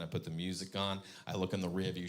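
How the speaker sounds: normal talking voice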